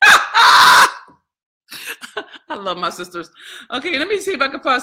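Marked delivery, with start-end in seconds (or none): 0.0-0.9 loud, high laughing cry; burst of laughter. Real, not acted. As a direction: explode into a delighted laugh. Bursts out at a viewer's message.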